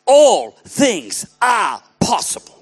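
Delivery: slow, loud, word-by-word declaration; big emphatic pulpit shout; reading out loud together. Shown as a demonstration. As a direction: proclaim each word separately for a crowd to join. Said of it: "read it with me out loud".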